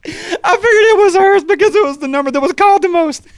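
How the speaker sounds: loud, high squeal through mock laughter; giddy mom. Mom explaining the number to her son, giddy squeal. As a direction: imitate a mom bursting with giggles. Giggling mom's confession acted out.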